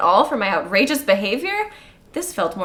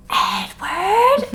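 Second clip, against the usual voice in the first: mock stage whisper; 11 semitones higher than usual. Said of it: "stage whispers out into the night".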